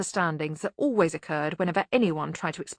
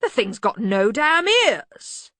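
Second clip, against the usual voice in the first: loud, slow, higher grumble; grumbling Arch-Chancellor; 5 semitones higher, 6 dB louder, much slower than usual. Acted. Arch-Chancellor objecting to the machine, loud grumble. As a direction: object with drawn-out bluster. Ridcully character dialogue.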